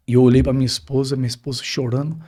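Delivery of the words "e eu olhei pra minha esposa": ordinary delivery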